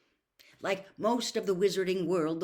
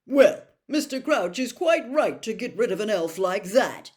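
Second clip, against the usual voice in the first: put-on higher, louder pompous young man; indignant Percy; 5 semitones higher, 12 dB louder than usual. A narrator's reading. Percy defending Mr Crouch to his family, indignant. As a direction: puff up with self-righteous indignation. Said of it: "Percy swelled indignantly".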